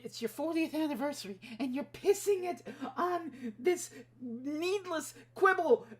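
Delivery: high-pitched indignant scolding; rising exasperation at a pointless squabble; exasperated rant. Real, not acted. Ranting at the franchise.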